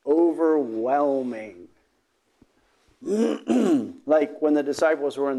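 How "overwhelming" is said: Overwhelming stretched slowly, every syllable lingered; drawn-out word. Shown as a demonstration. Preacher savouring the word Overwhelming, slow and drawn out. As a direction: let Overwhelming roll out like a wave. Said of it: "Originally related to covering".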